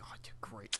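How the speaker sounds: breathy sarcastic whisper; mock whisper. Mocking early movie hype, sarcastic whisper. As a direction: hush it like a sarcastic aside.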